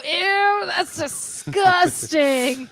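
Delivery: loud, drawn-out disgusted cry; hammed-up "ew" reaction; mock disgust. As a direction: stretch it out in exaggerated disgust. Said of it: "at least he didn't go".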